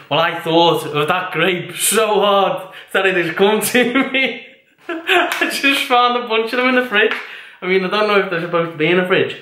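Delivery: laughing high and delighted; giddy excitement. Real, not acted. Vlogger delighted grapes showed up in her fridge, laughing. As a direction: gush about the magic grapes. Gleeful reveal of found grapes.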